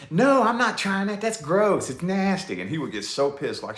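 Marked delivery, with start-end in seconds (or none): 0.1-2.6 put-on higher, childish no, that's gross; whiny kid refusal. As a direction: imitate a picky kid turning it down. Child refusing anchovies, grandpa pushes.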